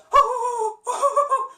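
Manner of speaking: high cartoon titter; Shaggy nervous laugh. Shown as a demonstration. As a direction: laugh scared through the nose and breath. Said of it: "Shaggy's laugh or his nervous laughter".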